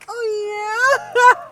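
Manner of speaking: very high, loud mock moan; exact squeaky impression. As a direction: nail his squeaky porn-voice. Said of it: "Exactly like that".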